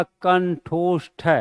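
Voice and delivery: कंठोष्ठ said slowly with clear ष्ठ; correct pronunciation. Shown as a demonstration. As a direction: model कंठोष्ठ slowly for the class. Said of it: "तो सही उच्चारण क्या हो गया".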